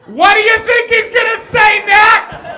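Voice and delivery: slow, high, full-force shout; drunk man's shouted whisper. Retold or imitated. Drunk man whispering to his neighbour, slow loud shouting. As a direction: shout slowly as if you think it's whispering. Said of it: "drunk man whispering where you're just speaking slower and yet still shouting".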